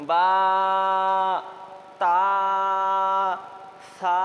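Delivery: বা, তা, সা each with a long held vowel; drawn out slowly. Shown as a demonstration. Teacher models letters for students, বা, তা, সা drawn out. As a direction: stretch বা, তা, সা slowly, one at a time. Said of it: "টান দিয়ে পড়তে হয় আস্তে আস্তে".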